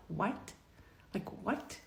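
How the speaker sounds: very soft, stunned question; barely voiced, questioning disbelief; hushed disbelief. Employee just told she is fired, hushed disbelief. Retold shock at being fired.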